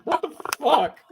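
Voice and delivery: high-pitched, laughing in disbelief; outraged high laughter. Real, not acted. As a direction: squeal in disgusted outrage. Outraged at the photo sequence.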